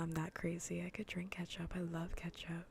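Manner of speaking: usual speaking voice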